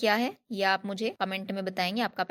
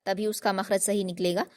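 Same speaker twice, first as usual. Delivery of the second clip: usual speaking voice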